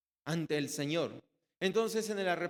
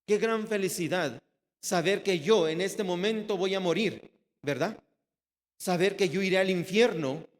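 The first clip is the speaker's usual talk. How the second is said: louder, impassioned sermon voice; fervent preaching; 3 semitones higher, 5 dB louder than usual. Acted. Preacher stirs the brothers with the thief's joy, fervent. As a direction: preach it with fire to the congregation.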